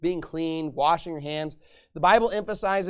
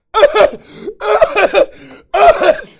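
burst of laughter; 14 semitones higher, 16 dB louder than usual. Real, not acted. Mocking inconsiderate people.

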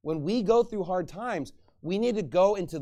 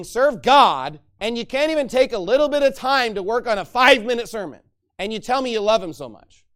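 loud rebuking sermon voice; preaching at full force; 5 semitones higher, 8 dB louder than usual. Acted. Pastor rebuking lazy student preachers, loud preaching. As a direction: scold them from the pulpit.

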